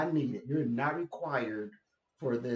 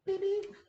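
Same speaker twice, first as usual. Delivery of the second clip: mock car horn. Mocking the one driver who honks, high beep beep. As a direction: beep beep squeaked far higher. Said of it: "that's like".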